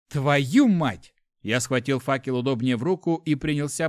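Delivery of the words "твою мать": louder, emphatic swearing; exasperated curse. A narrator's reading. Hero learns companion fears spiders, exasperated curse. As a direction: spit out a frustrated oath.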